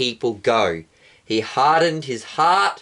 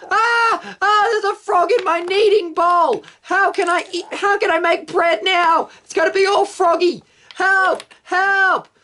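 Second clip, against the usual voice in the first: panicked shriek. Acted. Egyptian screaming at a frog in her hair, high panicked squeal. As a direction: shriek like frogs are in your hair.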